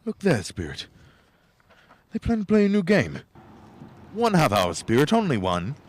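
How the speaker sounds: high, loud, eager begging; eager pleading. Acted. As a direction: plead like an excited child. Scrooge begs excitedly.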